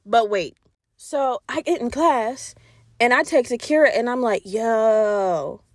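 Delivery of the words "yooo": "Yo" stretched long with many O's; drawn-out yo. Retold or imitated. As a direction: hold the O of "Yo" long. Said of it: "with all the O's".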